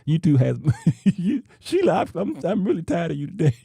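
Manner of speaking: real laughter breaking up the words; giggling too hard to finish cleanly; laughing. Real, not acted. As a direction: read it out through helpless high-pitched laughter. Laughs while reading listener's comment.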